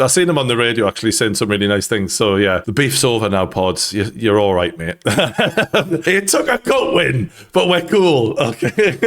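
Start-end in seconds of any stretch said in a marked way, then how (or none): 5.0-9.0 laughing. Real, not acted. Laughing at Pardew joke.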